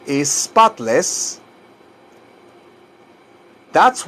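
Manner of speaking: slow, spaced-out phrase for learners; slow model phrase. Shown as a demonstration. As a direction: say it slowly for them to repeat.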